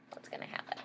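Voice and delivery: whispered teaser to the listeners; hushed storyteller question; suspenseful whisper. Acted. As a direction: breathe it as a secret. Aside to the child listeners.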